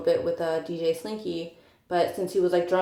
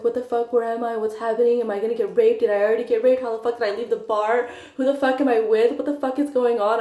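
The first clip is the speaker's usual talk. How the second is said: higher, a little louder, rapid-fire frantic questions; panicking; 4 semitones higher, 5 dB louder than usual. Retold or imitated. Retelling waking drunk in a stranger's bedroom, panicked questions. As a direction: relive the panic of waking up lost.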